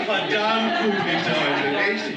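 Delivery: drawn-out, laughing self-praise; milk it slowly with a laugh; slow laughing tease. Pretend. Teasing himself on stage. (langsam, lachend, neckisch)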